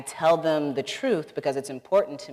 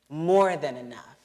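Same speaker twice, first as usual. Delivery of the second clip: ordinary delivery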